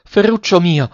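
high-pitched exclamation of alarm; startled and uneasy. A narrator's reading. Grandmother alarmed by her grandson's words, startled and uneasy. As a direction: cry out the name in worried surprise. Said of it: "esclamò la nonna stupita e inquieta".

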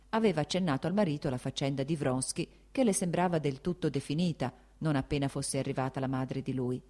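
ordinary delivery, nothing marked

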